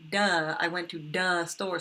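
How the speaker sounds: put-on "duh" with a D and a slow, childlike pace; kid's duh for the. Teacher imitating a child who says "duh" for "the". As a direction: say "duh store" slowly, like a small child. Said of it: "They'll say".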